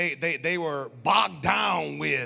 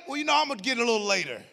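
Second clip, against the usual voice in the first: evasive excuse; 4 semitones higher, much faster than usual. Retold or imitated. His own dodging reply retold.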